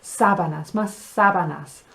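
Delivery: "sábanas" stressed as SA-ba-nas. Shown as a demonstration. Teacher modelling a request, SA-stressed "sábanas". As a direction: land on the first syllable of "sábanas". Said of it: "The accent on the sa".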